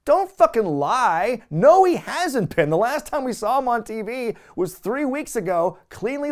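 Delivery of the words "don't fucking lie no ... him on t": exasperated outburst. Real, not acted. Podcaster yelling back at the commentators, exasperated and loud. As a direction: call out the lie, fed up and loud.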